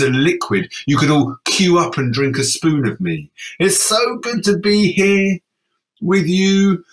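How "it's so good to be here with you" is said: high slow refrain. Acted.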